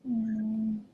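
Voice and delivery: usual speaking voice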